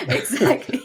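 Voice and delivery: high burst of laughter into exactly; laughing. Real, not acted. Guest laughs at the host's quip about negatives. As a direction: giggle then agree.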